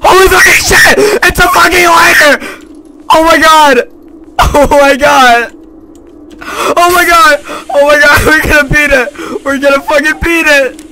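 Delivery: yelling in triumph, high and loud; over-the-moon celebration; ecstatic shouting. Real, not acted. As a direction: scream with disbelieving joy. Finds lighter, wild celebration.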